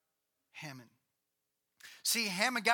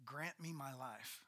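long pause, then grant me my life slow and much softer; long pause before; 5 semitones lower, 12 dB quieter, slower than usual. Shown as a demonstration. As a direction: hold the silence, then breathe out grant me my life. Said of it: "insert long pause right here".

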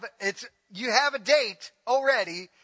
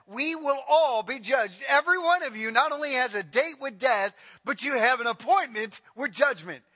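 louder, higher, fervent pulpit voice; preaching; 5 semitones higher, 4 dB louder than usual. Acted. Pastor warns everyone here of judgment day, fervent and louder. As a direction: drive each phrase at the listeners.

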